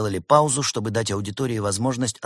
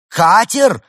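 high, very loud outraged question; enraged roar; 11 semitones higher, 11 dB louder than usual. A narrator's reading. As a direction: roar the word in disbelief. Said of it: "взревела Полигона".

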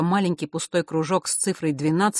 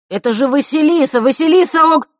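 высокий громкий выкрик из толпы; выкрикни её имя, пока не перебили; восклицание; 11 semitones higher, 10 dB louder than usual. A narrator's reading. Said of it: "воскликнул кто-то позади". (громкий выкрик)

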